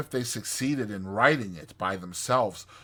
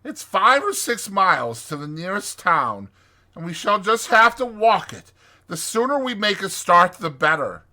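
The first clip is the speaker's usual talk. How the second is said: raised, grim resolve; grimly practical Rat, higher and louder; 10 semitones higher, 7 dB louder than usual. A narrator's reading. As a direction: lay out the hard march loudly. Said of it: "he said grimly to the Mole".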